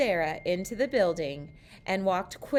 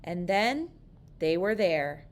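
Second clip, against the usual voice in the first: plain reading voice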